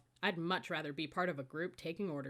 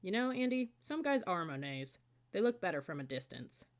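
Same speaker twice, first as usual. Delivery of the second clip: ordinary delivery, nothing marked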